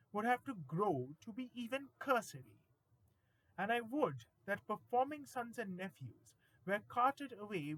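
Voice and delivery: dramatic poem recitation. Acted. Grown-up warns a small boy off his shoes, mock-stern recitation. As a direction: recite it theatrically, indignant at the child.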